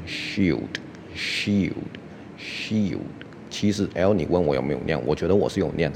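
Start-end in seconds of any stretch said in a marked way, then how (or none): 0.1-3.0 "shield" slow and clear, light L closing it; careful model pronunciation. Shown as a demonstration. Teacher telling learners to listen closely, careful slow "shield". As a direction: repeat "shield" slowly, letting the L fall away. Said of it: "听清楚，这个是念".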